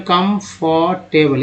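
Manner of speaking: Comfortable drawn out slowly, syllable by syllable; slow teaching demo of comfortable; slow spelled-out word. Shown as a demonstration.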